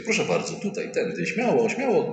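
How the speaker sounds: high, sugary welcome; mock eager host. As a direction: usher the priest in eagerly. (wysoki, przesłodzony gospodarz zapraszający księdza)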